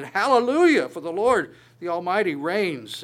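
loud, lifted cry of praise; thunderous praise. Retold or imitated. Preacher reading the multitude's praise in Revelation, loud cry. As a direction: proclaim it like a great multitude. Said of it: "the sound of mighty peals of thunder".

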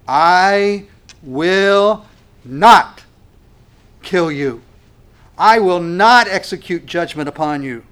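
loud, declaring pulpit voice; forceful preaching. Acted. Preacher declaring God will not destroy Ephraim, loud and emphatic. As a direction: thunder out God's promise word by word. Voicing God's promise forcefully.

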